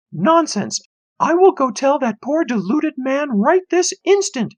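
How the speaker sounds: high, loud no-nonsense mom; firm mother. A narrator's reading. Mother brushing off her son before climbing up, high voice. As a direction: sweep his worry aside.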